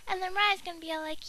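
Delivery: usual speaking voice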